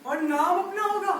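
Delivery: building, swelling daydream of riches, rising to loud peaks; dreamy boast. Acted. Circus man boasting to friends, swelling with excitement. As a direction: dream big and let it grow. Dreaming aloud of fame and wealth.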